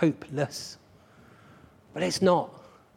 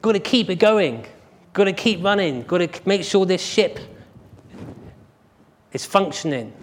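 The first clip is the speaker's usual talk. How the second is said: higher, louder, rushed officious complaining; hurried, pushy race official voice; fussy officials; 5 semitones higher, 5 dB louder, faster than usual. Retold or imitated. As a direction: play a flustered official clearing the track. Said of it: "probably the people saying".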